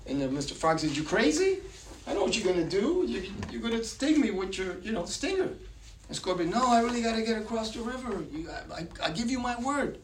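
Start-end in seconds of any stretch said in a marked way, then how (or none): 1.0-5.5 high-pitched, wary frog in a fable; incredulous frog. Acted. Frog suspects scorpion in told fable. 6.5-10.0 lightly voiced, higher-pitched pleading scorpion. Scorpion pleads with frog in fable.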